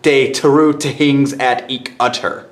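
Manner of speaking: "De teru tehings" with every TH turned into a T. Shown as a demonstration. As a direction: swap each TH for a hard T in "De teru tehings at ik utter". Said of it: "T H is pronounced as a T".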